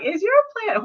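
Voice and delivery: a queen sneering at a messy place. Retold or imitated. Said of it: "she's just like".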